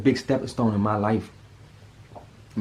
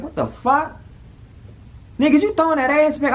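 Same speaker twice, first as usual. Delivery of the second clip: high, loud imitation of a stunned friend; mock outburst of disbelief, much higher and louder; shocked friend; 3 dB louder than usual. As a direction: play the buddy walking in stunned. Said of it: "He come in the room like".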